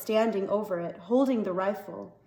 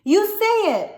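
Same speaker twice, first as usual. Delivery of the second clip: shrieking demand; 11 semitones higher, 7 dB louder than usual. Acted. Boy screaming at father.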